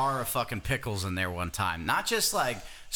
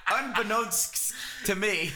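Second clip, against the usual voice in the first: slow careful; 8 semitones higher, slower than usual. Shown as a demonstration. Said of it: "repeating word slowly".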